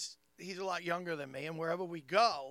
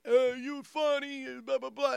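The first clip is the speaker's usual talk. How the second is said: imitating the bar guy; 7 semitones higher, 4 dB louder, slower than usual. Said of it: "The guy was like".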